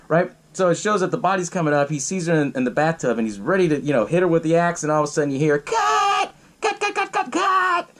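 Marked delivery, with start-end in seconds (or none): 5.6-7.9 much higher, frantic cry of cut; high mock director's shout; yelling cut. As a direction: shriek it like a panicked director. Director yells cut in scene.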